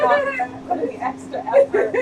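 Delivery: high and loud, laughing through it; laughing self-quote. Retold or imitated. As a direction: giggle through the mock boast. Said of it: "I was like".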